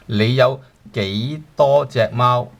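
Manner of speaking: 你有幾多隻貓 said slowly, word by word; slow teacher demo; slow model sentence. Shown as a demonstration. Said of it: "So how many cats do you have?".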